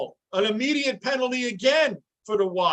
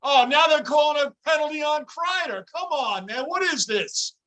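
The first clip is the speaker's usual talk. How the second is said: outraged; 6 semitones higher than usual. Real, not acted. Outraged at penalty call.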